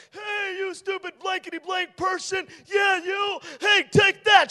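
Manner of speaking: high, shouted mock taunt; taunting bully. Preacher acting the stone thrower jeering at David, high mocking shout. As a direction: jeer and yell at him like a bully. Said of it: "guy comes out".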